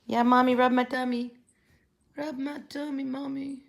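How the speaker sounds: high, loud pretend cat voice; voicing the cat. As her cat rolling over, high pleading pet voice. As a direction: speak for the cat begging for belly rubs. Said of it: "he's gonna roll over".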